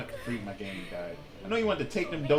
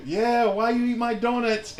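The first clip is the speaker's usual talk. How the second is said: louder, higher mock accusation; accusing coworker; 8 semitones higher, 7 dB louder than usual. Big coworker confronting Jonathan, mock accusing. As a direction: demand an answer, half-playful. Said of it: "he said".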